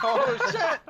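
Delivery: high, loud yelp breaking into laughter; real startled yell. Real, not acted. Player caught off guard in game, high startled laughing yell.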